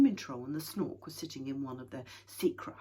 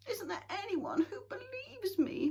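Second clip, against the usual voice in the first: pleading; 4 dB louder than usual. A narrator's reading. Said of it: "Moomin Troll pleaded".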